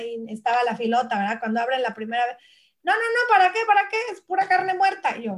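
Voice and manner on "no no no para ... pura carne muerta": high, firm mother refusing; imitating a strict hippie mom. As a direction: voice mom's flat no to fast food, pitched up. Voicing her mother's refusal.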